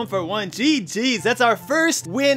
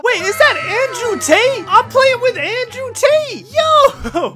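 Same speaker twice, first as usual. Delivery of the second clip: high, loud disbelieving shout; surprised shout; 11 semitones higher, 7 dB louder, slower than usual. Real, not acted. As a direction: yell it out in sudden disbelief. Recognizes famous teammate in game.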